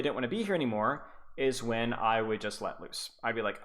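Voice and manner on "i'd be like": ordinary delivery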